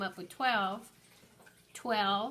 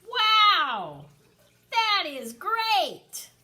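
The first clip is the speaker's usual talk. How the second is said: high, loud, slow burst of excitement; excited exclamation; 8 semitones higher, 11 dB louder, much slower than usual. Shown as a demonstration. Teacher showing kids an exclamation, thrilled. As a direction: exclaim it like wonderful news. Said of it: "because I'm very excited".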